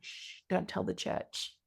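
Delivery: conspiratorial whisper, drawn out; a slow, playful shushing whisper; hushed secrecy. Retold or imitated. Coach jokingly swearing her client to secrecy, hushed whisper.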